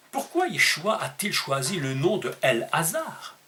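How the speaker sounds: usual speaking voice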